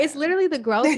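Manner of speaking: high, loud, real tearful insistence; overcome, near tears. Real, not acted. Host urged to take her time, tearfully exclaiming. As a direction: push it out high through the tears. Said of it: "I'm trying not to cry".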